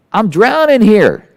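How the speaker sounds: slow, stretched, high plea; mock desperate plea. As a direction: drag it out like you're sinking. Pleading with passive dad.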